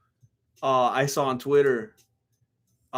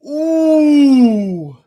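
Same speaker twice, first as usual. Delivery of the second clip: pained ooh; 9 semitones higher, 9 dB louder than usual. Real, not acted. Wincing at a brutal fight cut.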